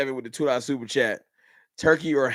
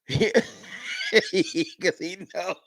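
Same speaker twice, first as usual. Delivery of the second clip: laughing; 4 semitones higher, much slower than usual. Real, not acted. Laughs at chatter who knows.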